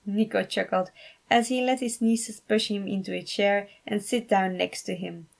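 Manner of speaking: usual speaking voice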